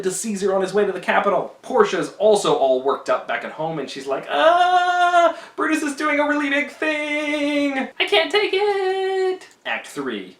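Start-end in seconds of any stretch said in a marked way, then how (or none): 4.3-9.5 much higher, louder, slow hammed-up panic; an overwrought wife who can't bear the suspense; frantic Portia. Portia all worked up at home.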